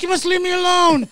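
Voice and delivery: high, loud hammed-up yell; mock yell. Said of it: "I'm like".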